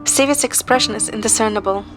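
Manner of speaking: usual speaking voice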